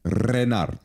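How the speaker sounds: Renard with a trilled r at the start; rolled r. Shown as a demonstration. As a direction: roll the r in Renard. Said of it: "I prefer the tongue roll".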